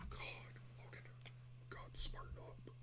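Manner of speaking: whispered self-scolding, under the breath. Real, not acted. Artist whispering a scolding to himself while drawing. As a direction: hiss it at yourself quietly.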